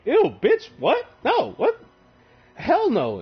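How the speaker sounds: very high, loud squeal of disgust; disgusted shriek. Retold or imitated. Fulgrim shrieking back at the sword, high and loud.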